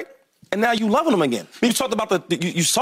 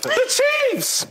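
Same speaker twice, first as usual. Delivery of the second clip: excited shout. Real, not acted. Boasting about beating the champs.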